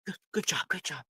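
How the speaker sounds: teacher quietly calming a shaken student; whispered praise. Acted. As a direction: comfort her quietly. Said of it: "whispers to her".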